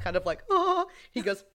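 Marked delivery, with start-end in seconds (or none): 0.5-0.9 high, louder imitated gasp; hammed-up "ohh" behind a hand; mock queasy "oh". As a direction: act grossed out with a high "oh". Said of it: "he's got his hands, like, over his mouth".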